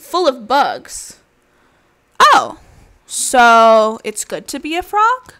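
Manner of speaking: lively storybook voice with animal voices; reading to children. Acted. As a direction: read it like a bedtime picture book. Reads picture book aloud.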